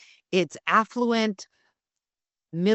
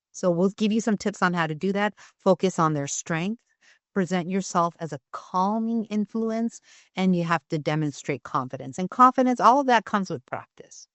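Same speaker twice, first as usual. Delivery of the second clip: normal talking voice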